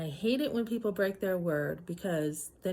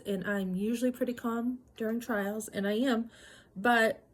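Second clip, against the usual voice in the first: normal talking voice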